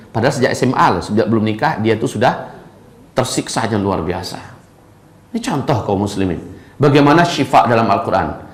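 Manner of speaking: ordinary talk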